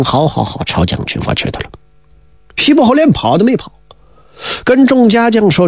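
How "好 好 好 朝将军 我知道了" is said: low, soft, half-whispered acceptance; resigned quiet reply. Acted. Marquis accepting the general's summons: quiet resigned murmur. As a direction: sigh it out quietly, giving in to fate. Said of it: "姬昌一听".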